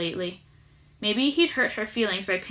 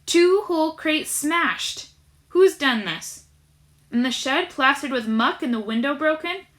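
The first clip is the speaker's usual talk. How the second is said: angry shouting; 5 semitones higher, 4 dB louder than usual. A narrator's reading. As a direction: rage at the workers. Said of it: "Chugger was shouting".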